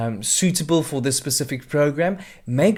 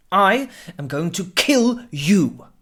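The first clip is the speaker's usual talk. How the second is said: slow, louder, each of "going", "kill", "you" stressed; menacing emphasized line; emphasis demo; 4 semitones higher, 5 dB louder, slower than usual. Shown as a demonstration. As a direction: punch the key words slowly. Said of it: "Mark the words you need to emphasize".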